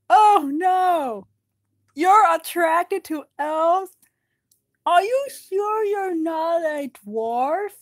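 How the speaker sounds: high, loud, slow mock horror; teasing dwarf. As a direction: drag out fake shock to tease. Nori teasing Keeley.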